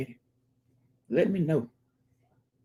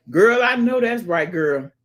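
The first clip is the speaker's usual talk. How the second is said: ordinary talk, nothing marked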